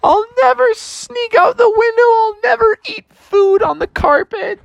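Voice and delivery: hammed-up high, tearful begging; desperate plea. Drowning bear begs for one wish, high tearful pleading. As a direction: wail the wish, climbing to a squeal. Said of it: "And then he said".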